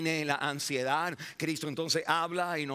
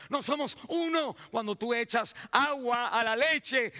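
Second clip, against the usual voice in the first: high, insistent pulpit voice; raised sermon cadence with stretched key words; impassioned preaching; 8 semitones higher than usual. Acted. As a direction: hammer out each phrase to the congregation.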